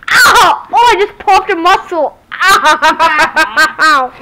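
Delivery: loud pained cry. Real, not acted. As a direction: groan through a popped muscle. Just hurt his knee.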